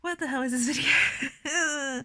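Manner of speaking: laugh-cry at herself; mock-baffled tearful laugh; laughing through tears. Real, not acted. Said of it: "I'm very emotional right now".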